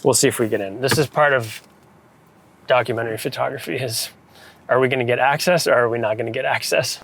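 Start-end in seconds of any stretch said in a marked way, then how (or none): none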